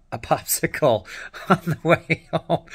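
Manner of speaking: laughing through the words, voice lifting; real laugh bubbling into the phrase; amused laughter. Real, not acted. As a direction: crack up at your own story. Laughs at the absurd ending.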